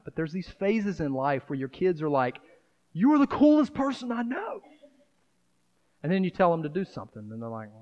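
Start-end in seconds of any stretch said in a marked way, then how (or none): 2.9-4.6 put-on much higher gushing kid voice; a kid gushing over their parent; imitating an adoring kid. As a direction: gush it like a starstruck child.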